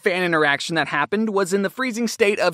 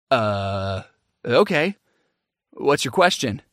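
low, halting, uneasy reply; wary hesitation; 9 semitones lower, slower than usual. Retold or imitated. Performer answering an overexcited fan, wary and hesitant. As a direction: drop low and hesitate warily.

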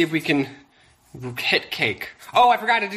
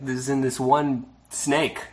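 ordinary delivery

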